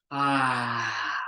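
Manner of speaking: long, loud released ah; pouring open ah. Shown as a demonstration. Leader letting it pour through, long open ah. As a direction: let an ah pour out. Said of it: "just let it pour through you".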